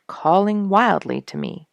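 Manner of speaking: ordinary delivery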